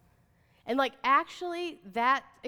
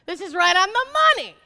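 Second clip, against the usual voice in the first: excited quote; 5 semitones higher, 11 dB louder than usual. Retold or imitated. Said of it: "I was like".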